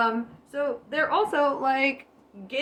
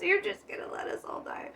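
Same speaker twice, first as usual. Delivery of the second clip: fast, high whispered plea; fearful whisper; 8 semitones higher, 7 dB quieter, faster than usual. Acted. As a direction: hiss it out in panic. Soldiers fear dying, acted.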